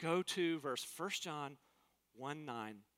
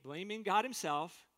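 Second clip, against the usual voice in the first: ordinary talk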